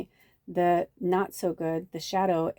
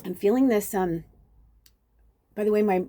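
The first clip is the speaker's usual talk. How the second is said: normal talking voice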